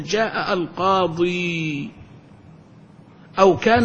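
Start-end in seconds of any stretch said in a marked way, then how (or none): 0.0-1.9 each word drawn out for the class; slow example sentence. Shown as a demonstration. Teacher reading a grammar example to students, slow and deliberate. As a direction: read the example very slowly and clearly. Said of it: "تقول".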